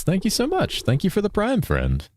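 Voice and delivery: high-pitched, sweet thank-you; pitched right up in gratitude; gushing thanks. Real, not acted. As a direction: thank them in a high, grateful lilt. Thanking viewer for subscription.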